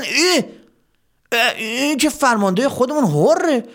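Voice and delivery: high-pitched, astonished soldier's cry; startled recognition. Acted. Soldiers suddenly recognise their commander Hor, startled.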